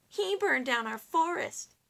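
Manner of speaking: king accusing, high and loud; crying out. Acted. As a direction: point the finger, upset. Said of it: "he cried".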